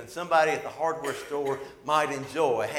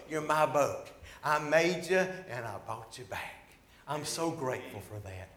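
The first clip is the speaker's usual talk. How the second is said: tender whisper to boat; 3 semitones lower, 6 dB quieter than usual. Retold or imitated. Boy holding boat to chest.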